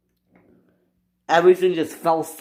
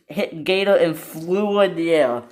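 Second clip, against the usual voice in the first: usual speaking voice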